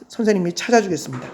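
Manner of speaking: ordinary delivery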